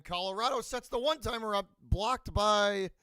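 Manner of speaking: excited. Real, not acted. Excited play call.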